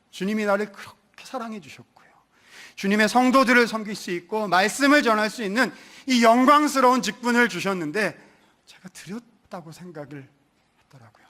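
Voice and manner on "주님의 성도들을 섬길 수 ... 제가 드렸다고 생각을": ordinary delivery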